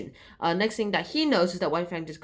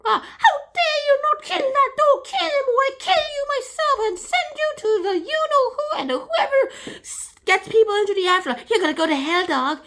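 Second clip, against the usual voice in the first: much higher, louder cackling villain voice; cruel villain; 7 dB louder than usual. Acted. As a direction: shriek threats like a cartoon villain. Beauty threatens the dog.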